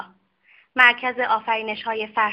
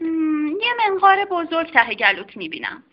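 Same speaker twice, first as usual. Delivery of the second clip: higher-pitched curious lady doctor; a brisk doctor examining a patient; doctor's voice; 5 semitones higher than usual. Acted. Lady doctor examining the strange dog, curious and higher. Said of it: "خانم دکتر ... گفت".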